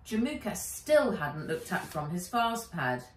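usual speaking voice

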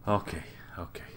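whispered okay. Real, not acted. Player settles his nerves, whispering to himself. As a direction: breathe it out to calm down. Calming himself after the shock.

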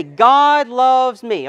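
slow, lifted, every word spaced; leading a call and response. Shown as a demonstration. Pastor modelling the words for cars across the road, slow and lifted. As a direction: model the phrase for them to repeat. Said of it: "I want you to say this with me".

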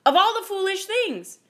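loud, high exasperated outburst; scornful rant, rising in pitch and force; cracking anger. A narrator's reading. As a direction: build to an indignant cry. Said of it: "her words cracking the air around her".

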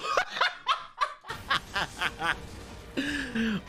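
high, helpless laughter; long laugh. Real, not acted. Streamer cracking up at the show, long high laugh.

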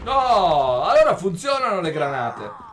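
loud, fired-up yell; shouting over the action; excited shout. Real, not acted. Yelling during gameplay. (grido eccitato)

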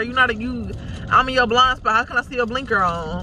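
normal talking voice

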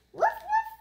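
high puppy yap. Acted. Child reading a puppy book, high puppy yap. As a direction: yip it high like a small dog.

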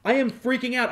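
playing Peter freaking out; mock panic. Peter panicking in retold comic.

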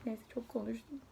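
soft whispered aside; half-whispered aside after a long story. Real, not acted. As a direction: whisper it to yourself.

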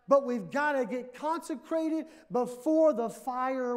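loud, high, slow fiery preaching; preaching at full force. Acted. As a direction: build it into a thundering altar call. Preaching climax.

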